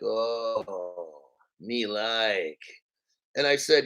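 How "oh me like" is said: deep, drawn-out mock approval; much lower, hammed-up pleased reaction; low, drawn-out relish. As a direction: drop your voice low and savor it. Acts out pleased reaction to Maersk.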